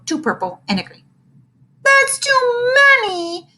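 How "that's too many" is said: high, slow wail of dismay; howling. Acted. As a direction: howl it like a kid losing candy. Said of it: "he howled".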